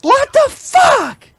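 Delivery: high, slow stunned what the fuck; shocked outburst. Real, not acted. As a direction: drag it out in disbelief. Shocked at caller's accusation.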